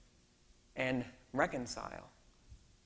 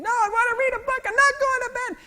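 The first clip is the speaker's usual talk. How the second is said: much higher, louder mock child wail; screaming toddler; 13 dB louder than usual. Sleepy daughter refusing dad, high mock child screaming. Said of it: "she's screaming".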